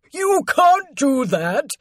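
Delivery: high, drawn-out objection; slow, high-pitched alarm; horrified protest. A narrator's reading. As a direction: cry out aghast to stop him. Servant protests paintings being slashed.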